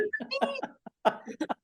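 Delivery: high-pitched breathless laugh; high squealing laugh. Real, not acted. Laughs after lamb voice.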